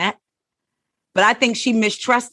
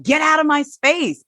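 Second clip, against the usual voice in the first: high-pitched imitated annoyance; acted irritated rebuff; 9 semitones higher than usual. Tammy rejecting a crying Beth, acted irritated rebuff. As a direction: push them away, voice jumping up.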